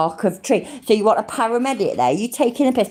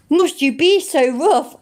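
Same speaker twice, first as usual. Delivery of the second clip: mock prissy; 7 semitones higher, 3 dB louder, slower than usual. Mocking the girl's line.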